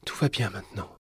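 soft, soothing reassurance. Acted. Mother holding her trembling child, soft soothing voice. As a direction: comfort them gently and quietly. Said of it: "sa voix douce".

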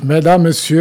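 ordinary delivery

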